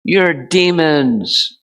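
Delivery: high, slow condemning jab; harsh accusing devotee. Retold or imitated. Quoting a preachy devotee who scolds others, slow and high. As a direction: drag out the insult as if preaching down at people. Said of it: "a devotee that at least used to speak like that".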